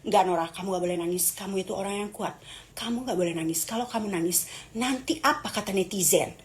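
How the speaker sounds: low, hushed pep talk to oneself; quiet, low consoling voice; self-soothing. Acted. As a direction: comfort yourself softly, pitch dropped. Soothing herself after near tears.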